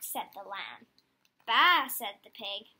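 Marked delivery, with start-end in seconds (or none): 1.5-1.9 loud bleating baa; loud sheep bleat. Acted. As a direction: bleat it out like a lamb. Animal noise in farm story.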